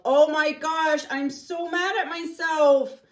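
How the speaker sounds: loud, high, slow exasperated cry; real frustration bursting out; mad at myself. Real, not acted. Painter scolds herself over the mistake, loud and exasperated.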